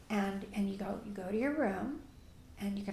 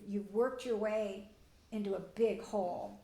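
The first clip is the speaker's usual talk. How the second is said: ordinary delivery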